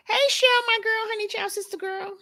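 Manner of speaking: high, bright calling out to a friend; high sing-song greeting. Real, not acted. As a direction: greet her in a high lilting voice.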